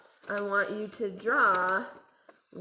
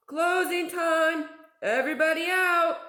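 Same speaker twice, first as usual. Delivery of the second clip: calling out; 8 semitones higher, 6 dB louder than usual. A narrator's reading. Manager calls out closing time, loud call. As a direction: boom it across the room. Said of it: "the manager of the laundromat called".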